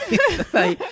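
high mock voice, laughing through the order; laughing Gaby imitation. Podcaster laughing as he repeats Gaby's order, high mock voice. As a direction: giggle while squeaking out the order to sit. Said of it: "Gaby le dijo".

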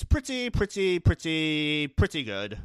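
very slow pretties, stretched; drawn-out pretty good. Shown as a demonstration. Rating the episode with a slow drawn-out pretty good. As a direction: savor each pretty, slowly.

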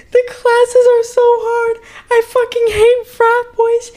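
hammed-up tearful pleading; imitated sobbing. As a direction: wail it like an overwhelmed student begging a cousin. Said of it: "Like, she's sobbing".

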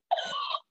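high sharp intake of breath; startled awed gasp. Retold or imitated. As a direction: gasp in awe. Heart dropped entering arena.

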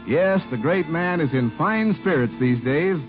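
ordinary talk

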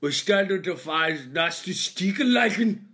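loud, high, aggressive mock German; fake German rant. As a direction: fire off German gibberish like an attack. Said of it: "I speak loud and aggressively in a fake German".

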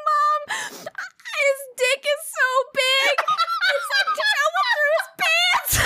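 very high, laugh-crying through the words; wheezing with laughter; laughing hard. Real, not acted. Telling mom about the doctor, laugh-crying.

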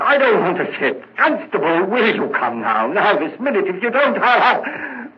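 panicked pleading. Acted. Panics, begs the constable to leave.

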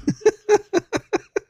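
high squealing burst of laughter; hysterical laugh. Real, not acted. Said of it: "Why are you laughing like that?".

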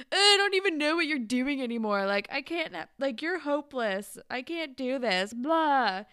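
loud, high retold rant; mock exasperation. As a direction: imitate a boy giving up in a fight. Exasperated boy's quarrel acted out.

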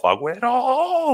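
name soaring into a long, high yell; drawn-out, high celebratory commentator's holler; imitated goal cry. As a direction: stretch the last word like a football commentator. Mimics the famous goal commentary cry.

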